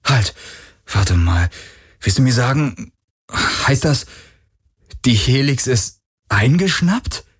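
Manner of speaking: Galen, startled and defensive; young hero voice. A narrator's reading. (leicht höhere Stimme eines jungen Helden)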